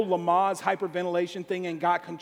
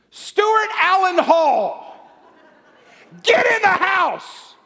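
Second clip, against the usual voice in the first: much higher, very loud slow yell; furious wife's yell; 11 semitones higher, 12 dB louder, much slower than usual. Retold or imitated. Wife shouting from the porch at her husband, loud slow yell. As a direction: call him in like a mother using his full name. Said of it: "It was my wife".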